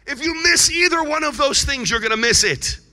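high, loud preacher's warning; loud, urgent pulpit insistence; emphatic warning. Real, not acted. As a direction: raise the voice and press it home. Preacher warning the congregation forcefully.

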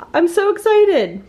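real thrilled squeal; excited. Real, not acted. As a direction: let the excitement burst out. Thrilled vlogger after cake success.

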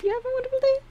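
high, fast, squeaky sign-off; cutesy high voice. Pretend. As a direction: chirp the goodbye like a cartoon.

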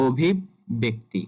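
"অভিব্যক্তি" slowly as obhib, bekti; ব-এ ধাক্কা. Shown as a demonstration. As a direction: break on a doubled b in "অভিব্যক্তি". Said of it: "বয়ে বলতে গিয়ে একটা ধাক্কা হবে". ("অভিব্যক্তি" ধীরে, ব-এ ধাক্কা)

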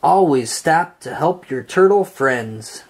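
ordinary delivery

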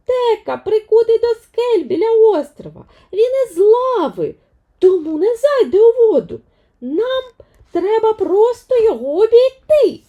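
put-on eager young heroine insisting; persistent Vaiana. Vaiana won't give up on Maui, high and loud. Vaiana explains her plan.

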